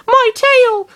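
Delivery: loud, very high shriek; yell. A narrator's reading. Lucario over burning tail, high yell. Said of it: "Lucario yelled".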